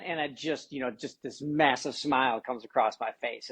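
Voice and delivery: usual speaking voice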